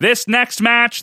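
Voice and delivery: put-on slow, nasal old-timey fight announcer; boxing announcer voice. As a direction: call the match like a vintage boxing emcee. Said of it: "where it's very nasal".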